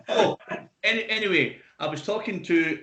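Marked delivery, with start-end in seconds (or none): none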